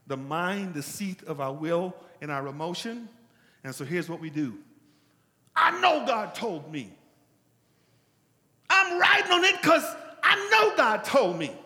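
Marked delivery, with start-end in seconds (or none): 5.5-11.6 loud, high, slowed mocking claim; mock insistence. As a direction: parody the stubborn believer. Mocking a self-sure believer.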